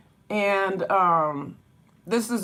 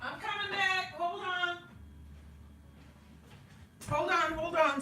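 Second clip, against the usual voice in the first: distant, high call from another room; faint far-off call; 9 semitones higher, 12 dB quieter than usual. Real, not acted. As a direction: call back from out of shot. Calling from away.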